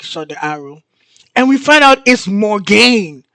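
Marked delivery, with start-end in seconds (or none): 1.4-3.2 higher, louder scandalized reveal; shocked reveal. Real, not acted. Telling viewers who shot the arrow, shocked reveal. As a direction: hit the culprit's name in high disbelief.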